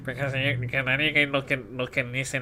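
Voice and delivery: usual speaking voice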